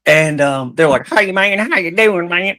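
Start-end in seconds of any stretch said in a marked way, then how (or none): 1.1-2.6 high, twangy country hello, pitched up; mock country greeting. The girlfriend's farm parents greet him, in a mock country accent. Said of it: "that's how they're".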